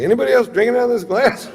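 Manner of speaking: high, fast, loud accusation; comic outraged shout, rushed and high; indignant drunken yell. Retold or imitated. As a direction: demand answers in a high-pitched yell. Drunk man accuses others over glass.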